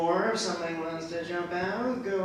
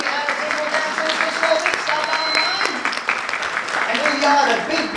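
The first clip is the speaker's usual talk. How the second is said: loud, high hype host; announcer voice; 11 semitones higher, 10 dB louder than usual. Acted. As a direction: announce it to the crowd. Host promotes to crowd.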